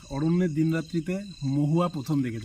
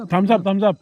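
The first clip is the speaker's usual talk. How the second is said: bright, raised thumbs-up cheer; excited; 6 semitones higher, 5 dB louder than usual. Real, not acted. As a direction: cheer it, louder and higher. Approving the food to camera.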